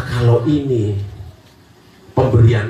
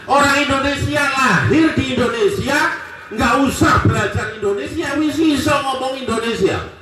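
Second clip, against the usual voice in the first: fiery preaching; 11 semitones higher than usual. Acted. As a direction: preach it up at full force to the crowd. Preacher rouses the congregation. (ceramah berapi-api dengan suara tinggi dan lantang)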